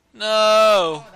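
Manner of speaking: long, loud, high cry of no; dismayed shout. Real, not acted. Caster watching the game collapse, shouting in dismay. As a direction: wail it as the game slips away. Caster reacts to losing units.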